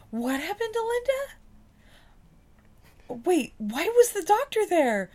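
frantic mother on the phone; panicked mom. Retold or imitated. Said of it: "the mom's on the phone and was like".

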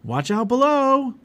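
high, loud, stretched-out holler; shouted warning. A narrator's reading. Boy sliding down the snowbank, shouted warning. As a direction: call it down a slope, long and loud. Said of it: "he shouted".

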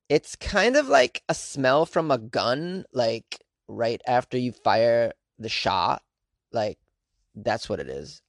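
slow, dopey airhead drawl; dumb person voice. Retold or imitated. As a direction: read it like a clueless airhead. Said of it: "my impression of an astronaut that was dumb".

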